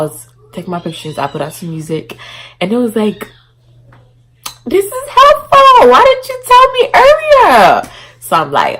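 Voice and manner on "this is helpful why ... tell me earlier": high, loud, slow amazement; excited. Retold or imitated. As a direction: gush with loud delight. Voices herself excited.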